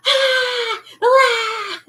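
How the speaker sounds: high, loud re-enacted shrieks; mock-terrified screams, very high; scared scream. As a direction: scream like a kid fooled by a trick. Child screams at magic trick.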